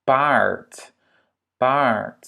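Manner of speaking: Part with a long, held A. Shown as a demonstration. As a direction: stretch the A in Part. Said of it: "Un A long". (« Part » avec un A long)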